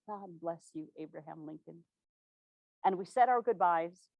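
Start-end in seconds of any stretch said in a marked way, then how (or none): 0.1-1.8 God bless you breathed much softer, almost inward; silent prayer. Acted. A silent prayer for the president as he holds her hand, hushed. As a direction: pray it under the breath. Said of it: "A silent prayer went up".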